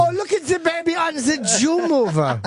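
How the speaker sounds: high-pitched, drawn-out mock baby talk; mock cooing. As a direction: coo it high and slow, hammed-up.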